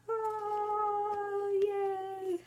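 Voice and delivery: high, gleeful oh yay; delighted. Real, not acted. Showing off her new chart to viewers, high gleeful delight. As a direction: squeal with delight.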